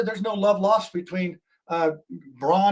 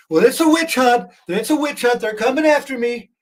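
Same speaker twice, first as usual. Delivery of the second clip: higher and louder mock Trump complaint; a light parody of Trump, raised and louder; Trump impression; 7 semitones higher, 5 dB louder than usual. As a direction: ham up Trump crying witch hunt. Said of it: "what does Trump say?".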